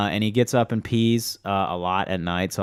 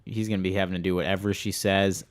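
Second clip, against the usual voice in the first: ordinary talk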